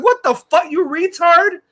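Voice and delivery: loud, high retold outburst; indignant retort. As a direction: burst out in acted indignation. Retold older fan's retort to commenters.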